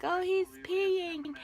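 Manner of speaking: high, drawn-out exclamation; imitated cooing reaction. Staff reacting as newborn pees on mom, high and drawn out.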